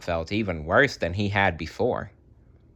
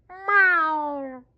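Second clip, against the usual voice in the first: high little snail meow. A narrator's reading. Oversized Gary letting out a meow.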